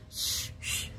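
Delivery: "Sh-, sh-" blown out as pure air; breathy sh sound. Shown as a demonstration. Showing grandma the German sound, just air. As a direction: push air through "Sh-, sh-". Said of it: "with air to blow".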